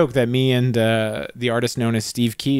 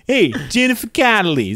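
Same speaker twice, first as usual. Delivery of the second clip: light mock Brooklyn accent, higher; a Brooklyn guy calling to a movie star; 7 semitones higher than usual. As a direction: call out in a hammed Brooklyn voice. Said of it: "the Brooklyn accent is part of it".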